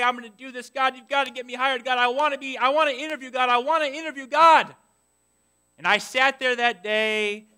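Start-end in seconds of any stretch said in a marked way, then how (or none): 0.0-4.7 pleading with God, rising higher and louder; eager pleading prayer. Retold or imitated. Preacher retelling his eager prayer to God. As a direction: beg God for the interview, building.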